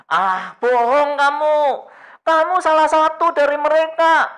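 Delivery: high, loud accusation; sharp accusing bystander; accusing woman. Retold or imitated. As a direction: call him out as a liar. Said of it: "si ibu-ibu tadi mengatakan".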